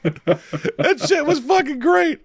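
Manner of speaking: high, slow, laughing through the words; can barely get it out for laughing. Real, not acted.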